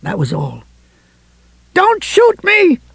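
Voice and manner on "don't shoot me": slow, very high desperate cry. A narrator's reading. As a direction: call out frantically to armed police. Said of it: "Helen was crying at the police".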